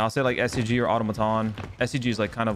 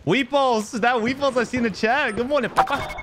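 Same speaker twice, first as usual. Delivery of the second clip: excited greeting; 12 semitones higher than usual. Real, not acted. Greeting a chat viewer.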